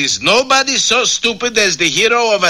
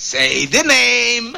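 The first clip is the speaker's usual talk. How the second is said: slow dramatic; 3 semitones higher, much slower than usual. Acted. Dramatic build to villain name.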